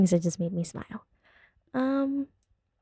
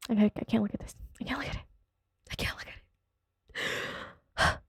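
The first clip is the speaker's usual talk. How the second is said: soft, fading into whisper; overcome, trailing off to a stretched whisper; mock upset; 4 semitones higher, 8 dB quieter than usual. Can't look at this family, mock upset. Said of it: "I'm upset".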